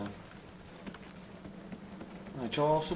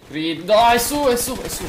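loud, high frantic callout; urgent shout; 11 semitones higher, 13 dB louder than usual. Real, not acted. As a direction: yell the warning fast.

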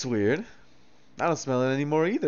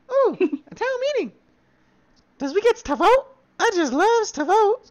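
much higher, fast goofy voice; silly squeaky character; 4 dB louder than usual. Pretend. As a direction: squeak it like a dim little critter.

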